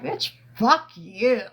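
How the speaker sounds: mock courtroom defiance, slowed down; imitating a defiant yell. Retelling the cousin cursing out the court, mock defiant. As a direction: throw it at them like he did. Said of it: "he was like".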